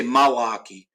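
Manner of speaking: "Milwaukee" as "Ma-waukee"; "Milwaukee" without its first l; local "Milwaukee". Shown as a demonstration. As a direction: say "Milwaukee" with "ma" for "mill". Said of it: "They'll say".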